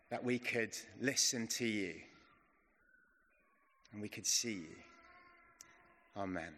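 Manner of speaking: slow, hushed prayer voice. Acted. Pastor leading the church in prayer, slow and hushed.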